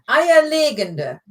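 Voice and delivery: Eierlegende drawn out, LE stressed, not GEN. Shown as a demonstration. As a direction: correct the learner with a long LE in Eierlegende. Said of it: "if you put the emphasis".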